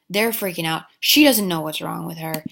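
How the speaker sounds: ordinary delivery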